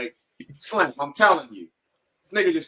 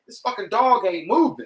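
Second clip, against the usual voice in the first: ordinary talk, nothing marked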